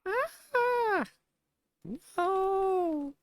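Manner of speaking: very high squealed cry; high-pitched cry. Acted. Player voicing a game character's protest, high-pitched cry.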